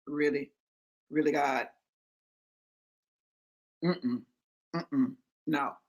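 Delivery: very low, quiet deadpan refusal; unimpressed. Acted. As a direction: drop low and soft, doubting and unimpressed. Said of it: "I would be like".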